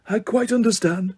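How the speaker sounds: soft, gentle understanding; calm reassurance. A narrator's reading. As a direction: reassure him kindly. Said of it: "said Dumbledore".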